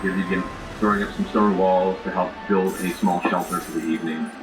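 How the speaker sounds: usual speaking voice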